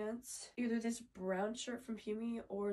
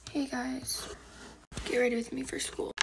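whispering; 3 semitones higher, 9 dB louder than usual. Real, not acted. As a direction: keep it all in a whisper. Whispers the whole video.

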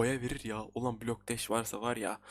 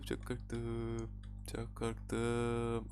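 slow soft repeat; 2 semitones lower, 7 dB quieter, much slower than usual. Real, not acted.

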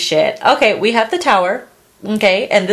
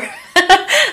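giggle; 12 semitones higher than usual. Real, not acted. Laughs at own joke.

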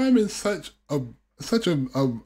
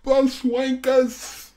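slow, stretched-out mock taunt; imitating Jay's jeer; 2 semitones higher, 3 dB quieter, much slower than usual. Fan repeating a favourite joke, drawn-out jeer. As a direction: drag both words out mockingly. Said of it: "He's like".

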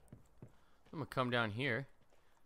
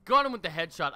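loud, high victory shout; excited cheering; 9 semitones higher, 10 dB louder than usual. Real, not acted. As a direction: whoop over the headshot. Celebrating a kill.

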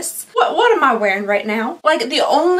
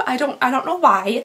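normal talking voice

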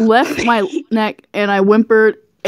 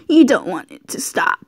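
usual speaking voice

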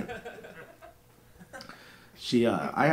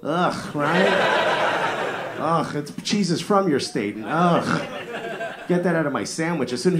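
loud, higher groans of disgust; mock disgust; 6 semitones higher, 4 dB louder, much slower than usual. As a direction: recoil in exaggerated revulsion. Mock disgust at audience member's provolone.

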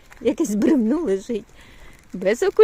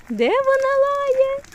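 slow, high drawn-out question; 4 dB louder, slower than usual. Real, not acted. Baby talk to toddler.

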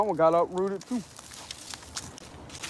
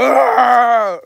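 long, louder mimicked growling scream; mock angry scream; 6 semitones higher, 5 dB louder than usual. Walker imitates how mad they're gonna be, mock scream. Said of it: "they really gonna be mad".

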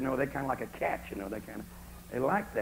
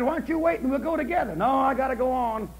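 voiced neighbors; 9 semitones higher, 6 dB louder than usual.

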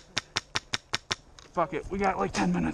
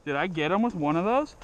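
ordinary delivery

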